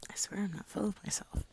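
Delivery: soft unvoiced whisper. Real, not acted. Whispering to viewers that she's not full of herself. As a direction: whisper it sheepishly.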